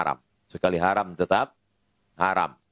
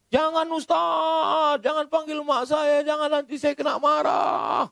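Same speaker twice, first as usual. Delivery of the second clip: put-on frightened child pleading; slower than usual. First grader begging the preacher not to call his mother, high frightened plea. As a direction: beg like a child about to cry. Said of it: "Mau nangis dia".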